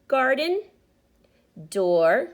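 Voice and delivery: ordinary delivery, nothing marked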